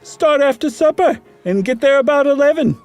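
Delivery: host proposing a ghost hunt; Meagle's voice. Acted.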